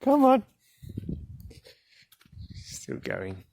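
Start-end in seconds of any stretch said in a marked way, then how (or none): 0.0-0.4 high, loud coaxing call; high call to cat. Real, not acted. Out on a walk, calls the lagging cat high and loud.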